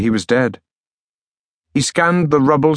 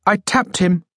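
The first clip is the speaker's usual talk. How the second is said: reedy voice; 7 semitones higher than usual. Acted. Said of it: "His voice reedy".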